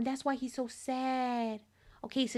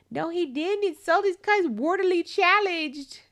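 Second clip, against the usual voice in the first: loud, raised, scandalised reaction; outraged disbelief; 5 semitones higher, 6 dB louder than usual. Real, not acted. Player reacting to a game character's insult, outraged. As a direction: call out the insult in amused shock.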